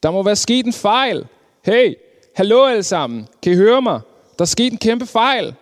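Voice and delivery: high, urgent storybook shout. A narrator's reading. Fishing boat calling to everyone aboard about a mistake, acted shout. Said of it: "begyndte at råbe".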